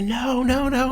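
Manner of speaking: retold high-pitched yell of no, no, no. To the man bouncing the injured guy, a high yell. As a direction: shout it up high to stop him. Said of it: "I'm yelling".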